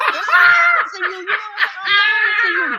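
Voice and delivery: loud, high shout through laughter; howling with laughter, shouting; laughing shout. Real, not acted. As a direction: yell it while cracking up. Laughing shout denying lying.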